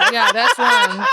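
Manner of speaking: squealing with laughter; laughing. Real, not acted. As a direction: crack up as you repeat it. Laughing at intrusive thought.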